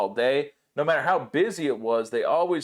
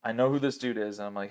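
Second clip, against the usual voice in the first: normal talking voice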